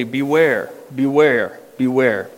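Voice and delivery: low falling beware repeated three times; threefold beware. Acted. Preacher echoing the translation to the church, low and falling. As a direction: toll beware like a bell. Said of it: "as some translations say".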